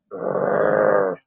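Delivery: a low, rough rolled "Grrr" like a harsh French R; aggressive French R growl. Shown as a demonstration. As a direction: growl out "Grrr" to show a strong R. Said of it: "it's more aggressive".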